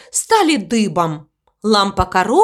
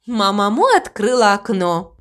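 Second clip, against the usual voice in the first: plain reading voice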